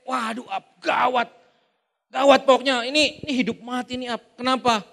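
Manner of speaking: hammed-up alarmed call; mock panic. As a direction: exaggerate the emergency. (panik dibuat-buat, suara meninggi)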